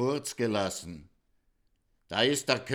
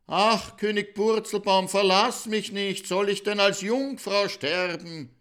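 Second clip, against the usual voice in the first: high, loud, pleading bride's wail; bride crying after him; 9 semitones higher, 5 dB louder than usual. Acted. The abandoned bride shouts pleadingly after the king. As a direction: call desperately after the leaving king. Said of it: "Hat die Braut ihm nachgeschrien".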